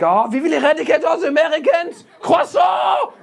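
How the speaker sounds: very high ranting French dictator; French Hitler. Acted. Comic imagining France had Hitler, high shouted French rant. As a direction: shout mock French like a tyrant at a rally. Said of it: "If French people had Hitler".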